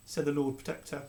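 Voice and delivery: ordinary delivery, nothing marked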